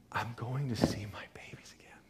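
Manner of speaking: breathy retold whisper, almost no voice. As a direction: whisper it like a mother overcome with joy. Patient's tearful words retold.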